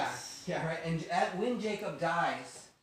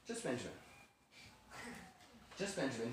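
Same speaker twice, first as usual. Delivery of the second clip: quiet verdict; 4 semitones lower, 12 dB quieter, faster than usual. Retold or imitated. Joseph calmly rules on one culprit.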